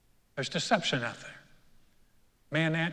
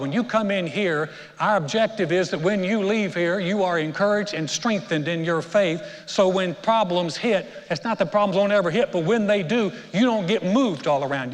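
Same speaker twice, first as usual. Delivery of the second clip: louder, higher, building preacher's call; rising preaching; 3 semitones higher, 5 dB louder than usual. Real, not acted. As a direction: drive it home to the congregation.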